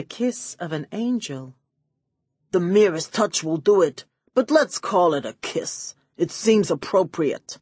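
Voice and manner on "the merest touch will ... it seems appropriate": smug villain. A narrator's reading. Villain smugly revealing his method, lightly voiced. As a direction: savour the answer.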